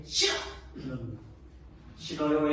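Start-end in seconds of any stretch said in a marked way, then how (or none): 0.0-0.3 a mock-sharp, sulky "싫어!" tossed back; sharp whiny refusal. Father imitating daughter refusing to wash, sharp and sulky. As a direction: snap "싫어!" like a stubborn child refusing. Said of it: "날카롭게 앙탈지게".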